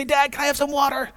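a little son asking Dad; child's voice. Retold or imitated. Child asks father for water.

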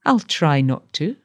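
plain reading voice

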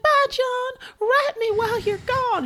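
very high falsetto sweetheart impression; Vivian. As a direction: play a hammed-up lovesick farewell in a squeaky-high voice. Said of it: "She's like".

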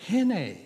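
"Hine." said as "hee-neh", short and matter-of-fact; an Israeli friend handing over a wrench, saying hine plainly; Hebrew word hine. Retold or imitated. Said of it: "and he said".

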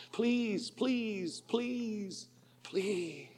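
high, soft pleas dying away to almost nothing; fading desperate begging. Retold or imitated. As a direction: beg weaker and weaker until the voice fades out. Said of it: "Then these words".

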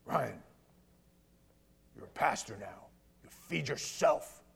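low, measured voice of a stern pastor; stern rebuke. Retold or imitated. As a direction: imitate a firm mentor laying down the law. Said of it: "he rebuked me, like, sternly".

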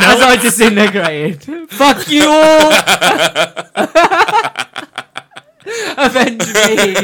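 loud hammy dying hero, laughing; overacted death scene. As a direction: ham up a dramatic death. Mock dying Marvel hero.

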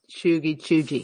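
"chuggy" with a hard g, then "chuggy" like choo-jee; two pronunciations. Shown as a demonstration. Co-host demonstrating how to pronounce chuggy, two contrasting pronunciations. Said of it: "how to pronounce".

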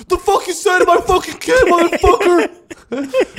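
a mock-enraged father cracking up mid-threat; mock furious dad. Dad threatening the roaster over his kid, high hammed-up rage.

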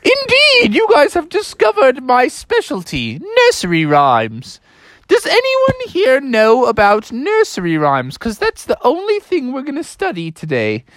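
high falsetto substitute teacher; Mother Goose. Acted. Teacher reveals her specialty to pupils, high theatrical goose. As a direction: announce it proudly, high and theatrical. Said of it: "And she was like".

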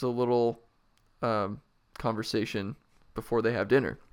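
lively read-aloud with voiced characters; story reading. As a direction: read the book out to a friend. Reads a book aloud with voices.

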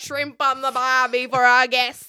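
hammed-up Australian cliché, laughing; joking Australian barbecue line; mock Australian. Host mocking an Australian guest in a hammed-up Australian accent. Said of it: "Crikey, mates".

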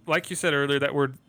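ordinary delivery

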